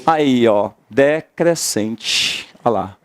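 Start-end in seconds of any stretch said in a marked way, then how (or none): 0.9-2.5 decrescente stretched slowly; teacher stretching the answer; slow drawn out. Shown as a demonstration. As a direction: draw the word out for students. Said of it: "Esse ditongo é o quê?".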